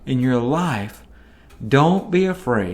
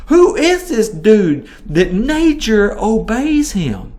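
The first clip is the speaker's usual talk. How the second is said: slow, higher, amazed question; awed disbelief; 6 semitones higher, 4 dB louder, slower than usual. Retold or imitated. Disciples marvel at Jesus calming the storm, slow awed question. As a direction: gasp it out in slow wonder.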